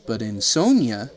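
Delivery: ordinary delivery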